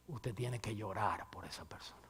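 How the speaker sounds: hushed whisper on the point; whispered sermon point. Real, not acted. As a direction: lean in and breathe it. Preacher drops to whisper.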